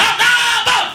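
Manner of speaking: high, sudden yelp of despair; panicked shout. Retold or imitated. Green Jeans cracking in career hell, high panicked yell. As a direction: blurt it out as everything snaps. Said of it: "realized he was in career hell and went".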